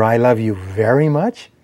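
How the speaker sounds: slow, with "very" emphasised, rising at the end; stress on VERY. Shown as a demonstration. Speaker showing how emphasis changes meaning, stress on "very". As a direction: lean hard on "very", end questioning. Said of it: "put the intonation or the emphasis on the words".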